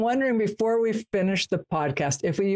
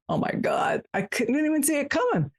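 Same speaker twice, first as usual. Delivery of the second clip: mock amazement, leaping high and quicker; amazed realization; 9 semitones higher than usual. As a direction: act out finally getting the joke, voice jumping up. Reaction to suddenly getting a joke.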